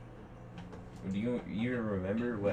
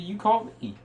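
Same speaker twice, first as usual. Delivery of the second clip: loud, higher indignant protest; indignant shout; 12 semitones higher, 6 dB louder, faster than usual. Real, not acted. To her call partner during a bicker, indignant shout. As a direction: throw it back at him, loud and high. Late-night bicker on the phone.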